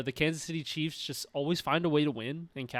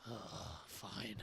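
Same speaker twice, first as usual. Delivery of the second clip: grudging sigh; 6 semitones lower, 20 dB quieter than usual. Retold or imitated. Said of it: "he's like".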